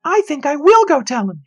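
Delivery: high, loud smug sister voice; smirking sister. A narrator's reading. Sister smugly deciding to climb up, high and loud.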